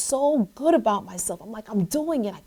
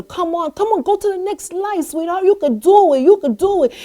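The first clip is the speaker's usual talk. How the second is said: higher-pitched, lightly acted encouragement; urging dad; 6 semitones higher than usual. Father cheering daughter to keep going, lightly acted urging. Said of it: "daddy's telling her to".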